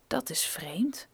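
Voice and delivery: slow, breathy whisper to himself; whispered thought. A narrator's reading. Geologist whispering to himself as the helicopter nears. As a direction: mutter it under the breath, puzzled. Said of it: "dacht hij".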